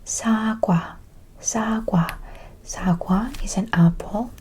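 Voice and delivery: Sa kwa whispered loudly and firmly. Shown as a demonstration. Teacher drilling Sa kwa, apple, loudly. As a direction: project the word Sa kwa. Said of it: "all together".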